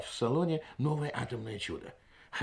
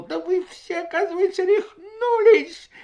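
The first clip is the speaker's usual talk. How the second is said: much higher, rising to a loud near-crying shout; desperate outcry; 8 dB louder, slower than usual. A narrator's reading. Doctor cries out to the guests, desperate and shouting. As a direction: cry out in despair at the guests. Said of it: "с отчаянием воскликнул".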